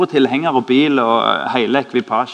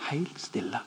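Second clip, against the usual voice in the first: hushed, slow; 11 dB quieter, slower than usual. Acted. Moment goes silent.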